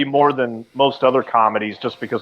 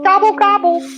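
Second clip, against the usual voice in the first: high squeaky turkey gobble; 4 dB louder than usual. Retold or imitated. Imitating a turkey noise.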